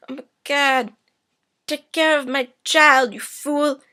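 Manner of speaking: high, loud hammed-up deathbed plea; mock dying plea. Dying mother begging him to take her child, loud and high. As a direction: ham up a dying woman's last words. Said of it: "her dying words are".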